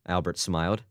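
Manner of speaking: ordinary delivery, nothing marked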